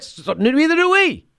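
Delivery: high, sing-song me-too reply; mocking Democrat. Mocking corporate Democrats agreeing with Republicans, high and sing-song. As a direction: chirp it back like a yes-man. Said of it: "that's why they sound like this".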